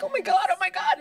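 high, loud giddy gush; excited gushing. Retold or imitated. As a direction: squeal with disbelief. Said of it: "so I'm like".

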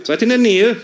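high, loud radio call; mother barking over the phone; mock radio call from mother; mother's call. Mother on phone, military radio talk.

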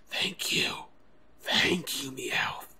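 whispered thanks. Acted. Grateful whisper to Meowth.

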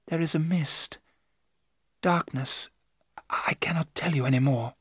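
hushed, fading trance voice; entranced. Acted. Mina tells what she sees under hypnosis, hushed. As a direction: softly report a vision, fading towards a whisper.

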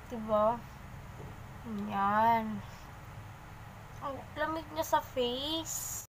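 speaking with lips framed by mask; slightly stiff lip movement; wearing a sheet face mask. Real, not acted. Seen 0: Wet sheet mask covers face throughout; mouth opening left uncovered.

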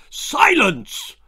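very high, loud shout; glowering father. A narrator's reading. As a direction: bellow for silence. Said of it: "glowered his father".

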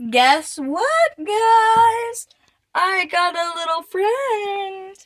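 high, loud, drawn-out reveal; excited announcement. Real, not acted. Vlogger announcing a little friend to viewers, excited and loud.

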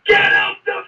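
very high, strained mock scream; screaming sergeant. Drill sergeant ordering recruits off the coach, imitated scream. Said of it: "he's screaming and shouting".

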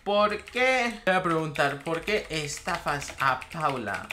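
normal talking voice

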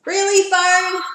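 loud, exasperated question; annoyed exclaim. Real, not acted. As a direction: complain loudly in exasperation. Annoyed at phone interruption.

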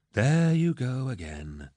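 slow, drawn-out weary exasperation; sighed. A narrator's reading. Captain cutting off the lord's protest, sighing. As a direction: sigh it out, stretching the words. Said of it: "Torgadden sighed".

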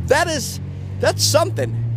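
high, impressed exclamation. Retold or imitated. Comic thinking aloud after the bus, high impressed tone. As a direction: marvel at it. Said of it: "and I thought".

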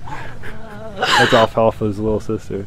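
slow words through a laugh; laughing while talking. Real, not acted.

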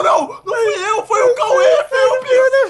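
very high, loud squealed protest; high-pitched shouting in self-defence; frantic denial. Real, not acted. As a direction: squeal it loudly, shifting the blame. Protesting blame for a hit.

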